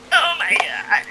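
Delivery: breathy whispered oh my god; whispered old man. Retold or imitated. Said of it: "Just like".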